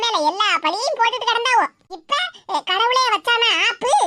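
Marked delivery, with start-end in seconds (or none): none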